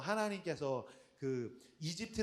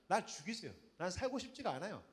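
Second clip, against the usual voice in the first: sulky plea; 9 dB quieter than usual. Retold or imitated. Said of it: "막 개기고 있었어요".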